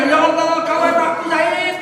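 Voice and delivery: crying out. Retold or imitated. Father crying on finding son.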